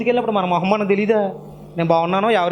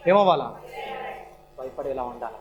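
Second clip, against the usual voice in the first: ordinary talk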